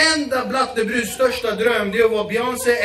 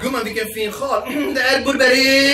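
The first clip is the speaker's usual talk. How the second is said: imitating Persian girls; 2 semitones higher, 5 dB louder than usual. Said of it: "inte kan uttala märkena".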